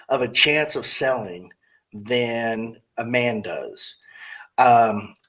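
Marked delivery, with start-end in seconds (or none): 0.1-4.0 slow, hushed confiding whisper; soft confidential whisper; whispered aside. Real, not acted. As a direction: lean in and whisper it. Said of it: "unvoiced whisper".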